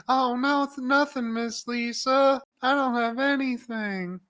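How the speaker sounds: put-on meek scared kid. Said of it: "And he's like".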